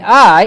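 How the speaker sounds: I punched loud; very emphatic I. Acted. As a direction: hit the word I hard, setting it against the other. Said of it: "and that's very emphatic".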